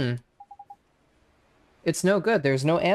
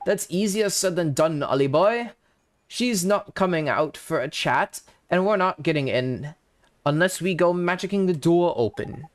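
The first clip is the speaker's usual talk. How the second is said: lilting Welsh fairy voice; cheeky Welsh sidekick; Welsh accent. Acted. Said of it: "the fucking drippy voice".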